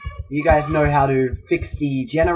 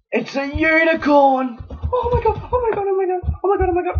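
high-pitched breathless delight; excited squeal. Real, not acted. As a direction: squeal it, overjoyed. Thrilled to find a unicorn.

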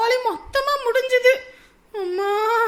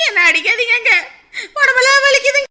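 loud crying cry of pain; crying plea; 2 semitones higher, 6 dB louder than usual. Acted. Wife begging husband to stop beating her, crying. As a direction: wail and beg him to stop.

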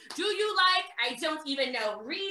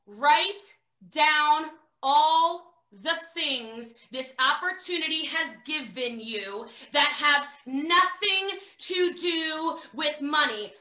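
slow deliberate dictation; slower than usual. Retold or imitated. Sponsor's instruction, drawn out.

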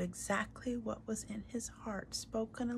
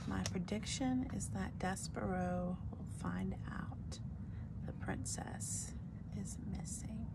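hushed, breathy whisper of a guess; whispered aside; 6 dB quieter than usual. Real, not acted. Teacher whispering her guess to the class. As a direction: lean in and whisper the prediction.

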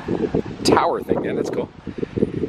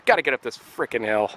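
ordinary talk

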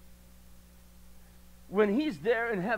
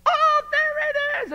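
put-on toddler's delight; 10 dB louder, slower than usual. Toddler finds hidden thing.

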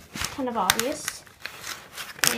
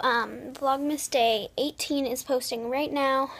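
ordinary delivery

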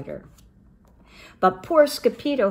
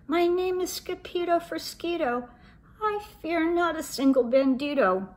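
small voice; 9 semitones higher than usual. Acted. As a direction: sound tiny and scared. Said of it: "in a muy, muy small voice".

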